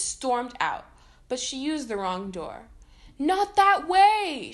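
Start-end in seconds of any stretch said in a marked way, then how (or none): 3.2-4.5 loud, high, drawn-out warning shout. A narrator's reading. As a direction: yell it to stop her going the wrong way. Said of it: "shouted Phineas".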